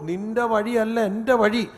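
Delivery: loud, measured declamation of scripture; forceful pulpit declaration; emphatic preaching. Acted. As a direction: proclaim it slowly to the congregation. Preacher declaims God's words.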